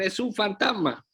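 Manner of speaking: retold frightened cry. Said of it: "comenzaron a gritar".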